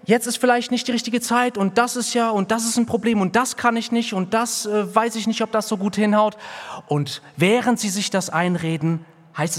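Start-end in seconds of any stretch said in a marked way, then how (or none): 0.0-6.4 high, rambling hedging voice; mock hesitant excuse. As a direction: ramble like someone dodging. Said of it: "Eure echte Diagnose ist".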